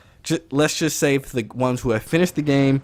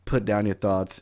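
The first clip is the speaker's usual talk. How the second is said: ordinary talk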